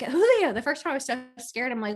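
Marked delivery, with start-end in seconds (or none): 0.0-0.5 much higher, louder call for the dog. Retold or imitated. Owner calling her dog in the yard, high and loud. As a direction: call the dog's name across the yard.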